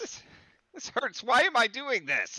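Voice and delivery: high-pitched anguished wail; acted pain. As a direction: wail in hammed-up agony. Player acts burned character's anguish.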